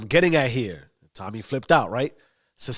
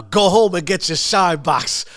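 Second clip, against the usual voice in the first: higher, louder mocking order; loud taunt; 4 semitones higher, 6 dB louder than usual. Bats baiting Tommy after one more toast, loudly taunting. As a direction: hurl the insult across the room.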